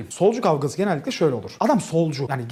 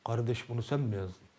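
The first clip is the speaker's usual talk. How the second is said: quiet menace; 8 semitones lower, 14 dB quieter than usual. Retold or imitated. Menacing nationalist youth.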